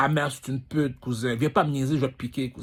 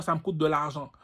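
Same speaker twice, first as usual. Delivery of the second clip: ordinary delivery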